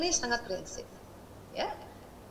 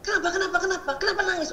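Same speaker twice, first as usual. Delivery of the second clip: put-on sharp, higher, pressing questions at the child; harsh impatient mother; 7 semitones higher, faster than usual. Tense mother snapping at a crying child. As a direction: nag the child harshly, over and over. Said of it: "Negosiasinya kasar banget".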